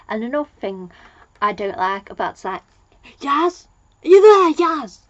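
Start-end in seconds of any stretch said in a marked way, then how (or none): 3.2-5.0 high, loud mock shout for someone; calling out loudly. Doctor calling Yaz on the communicator, high acted shout. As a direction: holler down a communicator, hammed-up. Acting an urgent call over communicator.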